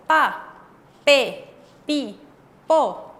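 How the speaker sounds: "pa, pe, pi, po" as separate punched syllables with a hard p; consonante doble. Shown as a demonstration. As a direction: demonstrate "pa, pe, pi, po" one at a time, p tensed. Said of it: "Ese es su sonido". ("pa, pe, pi, po" sílaba por sílaba, con p fuerte)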